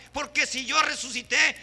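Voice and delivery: ordinary talk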